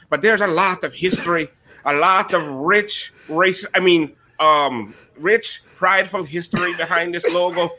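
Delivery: lightly voiced Irish leprechaun; a hint of stage-Irish leprechaun; Lucky the Leprechaun. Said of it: "exclusive with Lucky the Leprechaun".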